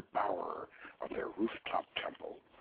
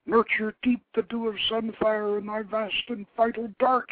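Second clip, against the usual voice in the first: gasped; 9 semitones higher, 6 dB louder than usual. A narrator's reading. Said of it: "he gasped".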